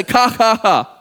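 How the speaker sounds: spoken laugh dropping at the end; mock Hebrew laugh. Shown as a demonstration. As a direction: demonstrate a laugh in deliberate syllables. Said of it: "the Hebrew's way of laughing".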